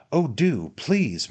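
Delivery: high, pleading coaxing; crying out. A narrator's reading. Ladies urge the old gentleman to slide, pleading. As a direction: beg eagerly, voice lifted. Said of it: "cried all the ladies".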